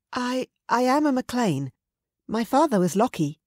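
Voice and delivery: high, hesitant stammered claim; nervous girl. Acted. Girl telling the raider her parentage, nervous. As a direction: stammer it timidly.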